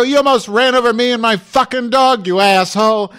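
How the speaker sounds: high furious yell; screaming. Retold or imitated. Confronting the driver through his window: furious yell. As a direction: tear into the driver. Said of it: "kept screaming at him".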